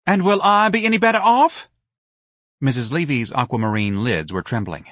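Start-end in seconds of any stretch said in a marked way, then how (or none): 0.1-1.7 high, loud indignant question; pitched-up lament, close to hysterical; distraught wife. A narrator's reading. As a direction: wail it at your husband.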